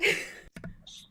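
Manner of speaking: breathy burst of laughter; short laugh. Real, not acted. Game master breaks before trying a character voice, short laugh. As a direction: crack up briefly.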